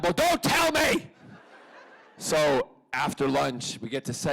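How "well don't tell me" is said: high, loud, slow laughing cry; mock protest. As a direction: yell it half laughing, begging him not to spoil it. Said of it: "I'm like".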